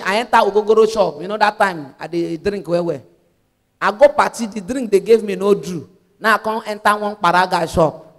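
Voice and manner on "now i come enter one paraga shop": ordinary talk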